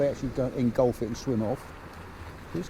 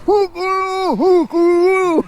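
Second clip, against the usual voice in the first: very high, loud muffled hooting; mouth-full holler; 8 dB louder, much slower than usual. Retold or imitated. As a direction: imitate a mate hollering through a bread roll. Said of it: "he's got a roll in his mouth".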